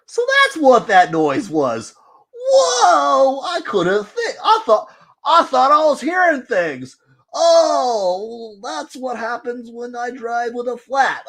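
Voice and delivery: high, crazy cackling voice, laughing through the words; squeaky, giggly cartoon madman who's just noticed his flat tire; goofy mad scientist voice. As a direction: play it like a Simpsons mad scientist. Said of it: "goofy, high-pitched, crazy, mad scientist voice".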